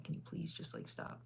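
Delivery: breathy whispered plea, half-joking; imitating a whisperer, hushed; mock whisper. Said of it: "when they start whispering".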